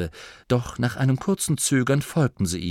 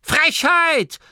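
put-on high, loud outraged old woman; an old granny's furious retort; indignant snap; 6 dB louder than usual. A narrator's reading. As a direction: spit it out, offended. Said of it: "fauchte Oma Morky".

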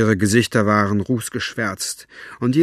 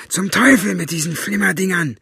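higher, gruff cursing voice; 8 semitones higher, 4 dB louder than usual. A narrator's reading. Buster curses the bitten fairy, angry and gruff. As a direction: spit out the curse in irritation. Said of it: "schimpfte er".

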